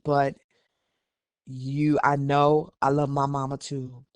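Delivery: ordinary delivery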